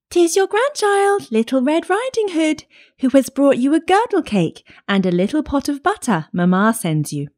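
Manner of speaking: put-on high-pitched little-girl voice; child calling brightly through the door, high. A narrator's reading. Granddaughter announcing herself at the door, high girlish voice.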